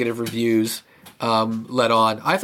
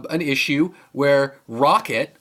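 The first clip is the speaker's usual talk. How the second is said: ordinary talk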